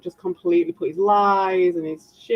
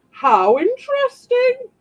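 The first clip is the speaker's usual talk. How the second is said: slow, drawn-out mock critic voice, louder and higher; sarcastic fine-dining praise; posh critic; 4 dB louder, slower than usual. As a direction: savour each word like a snooty judge.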